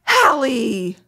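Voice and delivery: gasping call. Retold or imitated.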